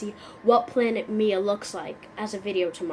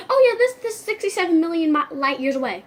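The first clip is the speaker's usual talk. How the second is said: nerd friend; 5 semitones higher, 5 dB louder than usual. Acted. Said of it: "And they're just like".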